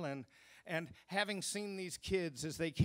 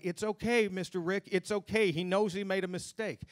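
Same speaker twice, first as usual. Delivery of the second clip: imitating pleading kids; 6 semitones higher, 4 dB louder than usual. Kids reassuring adult.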